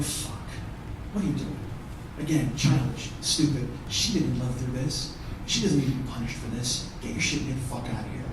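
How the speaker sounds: low, soft inner grumble; muttered exasperation. Retold or imitated. Comedian replaying his thoughts in the store, muttered exasperation.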